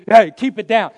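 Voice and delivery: loud, sharp hushing order; crowd hushing someone. Retold or imitated. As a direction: call out to silence a noisy man. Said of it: "They told him to be quiet".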